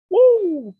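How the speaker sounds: loud, high hype whoop; excited whoop. Real, not acted. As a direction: let out a real cheer. Hype shout mid-rant.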